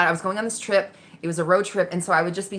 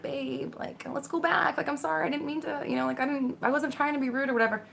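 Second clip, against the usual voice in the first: higher, softer apologetic husband; pleading husband; 7 semitones higher, 7 dB quieter than usual. Retold or imitated. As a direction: coax her back, sorry and soft. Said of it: "trailing behind me".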